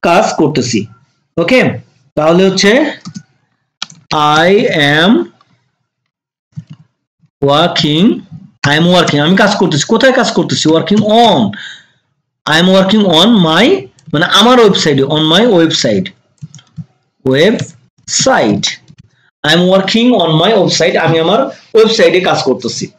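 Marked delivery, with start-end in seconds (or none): 4.4-8.0 long pauses between I, am and working; word by word. Shown as a demonstration. Teacher building the sentence for learners, word by word. 17.2-18.6 "website" split slowly into web, pause, site; syllable by syllable. Shown as a demonstration. Teacher showing learners the word, syllable by syllable.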